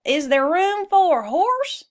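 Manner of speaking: high horse voice asking; hopeful horse. Acted. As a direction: ask like an eager horse. Mister Brown asks to join.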